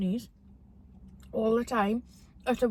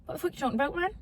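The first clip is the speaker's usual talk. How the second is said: a broad Geordie retort, a bit higher; 5 semitones higher, 4 dB quieter, much faster than usual. Pretend. Daughter starts a pretend chat, broad Geordie. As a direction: play up thick Geordie in mock conversation. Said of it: "be broad Geordie".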